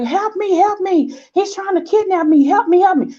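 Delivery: high, panicked pleading; screaming for help while running from him; frantic cry for help. Retold or imitated. Woman fleeing her ex to the security shack, high panicked cries. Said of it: "And she's like".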